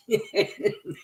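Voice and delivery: short high breathy giggle. Real, not acted. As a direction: burst into a quick giggle. Laughing at dog swallowing thong.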